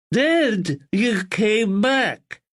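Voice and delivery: put-on high, slow, muffled young man; muffled, eager and slow. A narrator's reading. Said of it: "in his strange, muffled voice".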